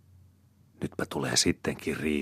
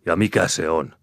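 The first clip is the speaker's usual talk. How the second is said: loud, stern question; angry demand; 2 semitones higher, 9 dB louder than usual. A narrator's reading. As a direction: confront her loudly. Angry man demands answer.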